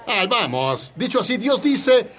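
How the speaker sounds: ordinary delivery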